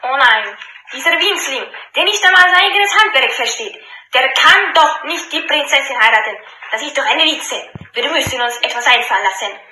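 loud, bitter jealous brother; envious outburst. Acted. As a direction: spit it out in envy. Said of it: "platzten jedoch vor lauter Neid". (neidisch und wütend)

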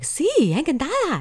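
high, sultry mock-sexy Spanish. Shown as a demonstration. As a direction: purr it like a flirt. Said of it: "the way that they speak is so sexy".

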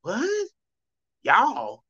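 high incredulous yelp on what; playing a baffled northerner; startled disbelief at y'all; mock northern confusion. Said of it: "But up north, when you say y'all".

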